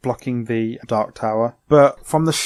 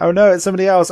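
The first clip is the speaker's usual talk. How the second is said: lightly acted alarm, higher and louder; mock panic; 7 semitones higher, 5 dB louder, much faster than usual. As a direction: imitate kids freaking out, raising pitch and volume. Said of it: "they all freak out".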